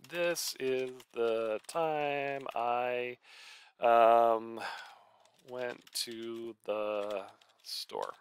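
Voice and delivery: very slow, word by word; slow dictation. Shown as a demonstration. Writer slowly dictating a sentence to the class. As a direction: read it out slowly as you type. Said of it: "I just wrote a sentence here".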